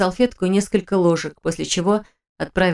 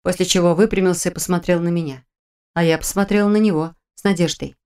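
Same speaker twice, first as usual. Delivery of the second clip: plain reading voice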